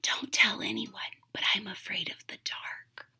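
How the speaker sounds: hushed voiceless confession; secret whisper. Acted. Witch telling the kitten her secret at bedtime, whispered. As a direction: whisper it like a secret nobody must hear.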